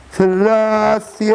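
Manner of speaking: long drawn high call; high drawn-out call to Selassie. Retold or imitated. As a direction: cry the name out, stretched. Calling on Selassie.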